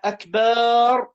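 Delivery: أكبر said as a long "akbaaar"; lengthened final syllable. Shown as a demonstration. Teacher repeats the invalid word, baa of أكبر drawn out. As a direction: drag out the vowel after ب in أكبر. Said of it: "عدم مد باء أكبر".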